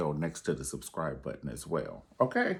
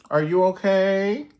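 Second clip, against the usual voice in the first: high, loud, slow fake-sweet concern; loud mock concern; 13 semitones higher, 12 dB louder than usual. Pusher calling to the fallen rival with loud, high fake concern. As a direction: call down the stairs with fake worry.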